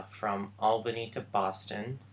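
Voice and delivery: normal talking voice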